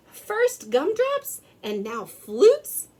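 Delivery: can this get worse; exasperated Santa. A narrator's reading. Santa losing gumdrops then flutes, exasperated.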